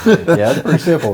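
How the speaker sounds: amused, words carried on a laugh. Real, not acted. Laughing at the Greek joke.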